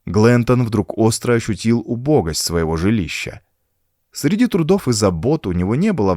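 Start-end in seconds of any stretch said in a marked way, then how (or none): none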